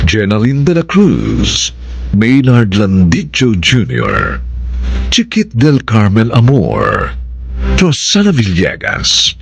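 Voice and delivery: ordinary talk, nothing marked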